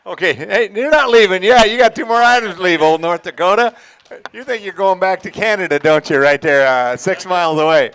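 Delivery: loud, high crowd hype; auction hype. Acted. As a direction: pump up the room like a ringman. Hyping the crowd before bidding.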